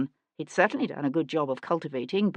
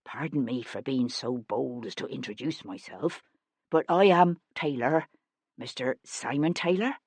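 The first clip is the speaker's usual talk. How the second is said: every sentence tilted up like a question; nervous man unsure of himself; lightly voiced timid stranger; unsure rising lilt; 5 semitones lower, 3 dB quieter than usual. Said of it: "brought the inflection of his voice up at the end".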